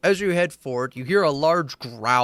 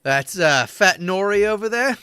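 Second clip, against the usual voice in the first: higher-voiced tavern local; gruff NPC guide; 7 semitones higher, 4 dB louder than usual. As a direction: point them out like a chatty local. GM voices NPC pointing out people.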